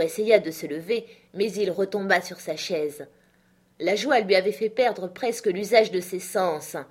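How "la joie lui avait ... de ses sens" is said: usual speaking voice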